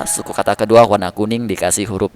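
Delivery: normal talking voice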